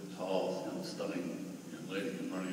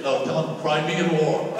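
louder, slower comic punchline; 10 semitones higher, 16 dB louder, slower than usual. Retold or imitated. Old man jokes to boy about wars, loud and slow. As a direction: land the joke big and slow.

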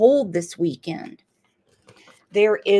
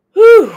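high, loud relieved whew; 10 semitones higher, 12 dB louder than usual. Real, not acted. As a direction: blow out a big whew. Loud exhale.

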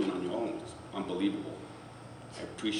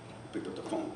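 ordinary delivery

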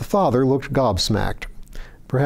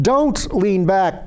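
higher, slow, drawn-out urgent plea; insistent shout; 8 semitones higher, much slower than usual. A narrator's reading. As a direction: insist hard, words stretched. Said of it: "Claude insisted".

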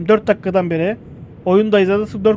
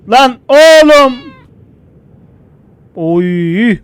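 loud, high, slow shocked yell; sudden yell; 5 semitones higher, 9 dB louder, much slower than usual. Real, not acted. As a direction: shout in alarm. Ani tehlike karşısında bağırış. (ani şaşkınlıkla bağırış)

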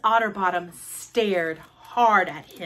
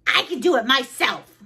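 loud, higher protest; shouting; 4 semitones higher, 5 dB louder than usual. Acted. Froggy refuses help picking up books, shouting. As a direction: shout it back like a stubborn kid. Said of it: "shouted Froggy".

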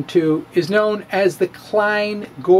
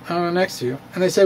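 ordinary talk, nothing marked

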